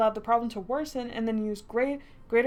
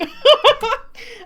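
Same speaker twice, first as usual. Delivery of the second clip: shrieking high burst of laughter; loud shrill cackle; 17 dB louder than usual. Real, not acted. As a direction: crack up loudly, squealing. Laughs at "if he's hot.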